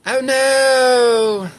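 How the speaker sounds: high, long-held mock "oh no"; Leslie. Reader attempting an impression, drawn-out high wail. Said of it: "I forgot what he sounds like".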